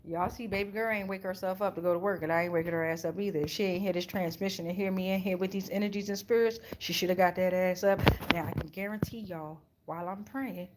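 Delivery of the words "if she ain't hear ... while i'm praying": ordinary talk, nothing marked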